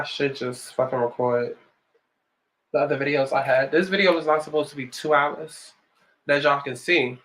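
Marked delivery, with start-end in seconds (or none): none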